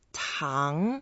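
tang with a rising tone; pronunciation demo. Shown as a demonstration. Teacher introducing the word for sugar, pronunciation demo. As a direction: model tang sliding upward. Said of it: "Now the third word".

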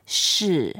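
是 falling in fourth tone. Shown as a demonstration. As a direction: say 是 going down. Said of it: "It's fourth tone going down".

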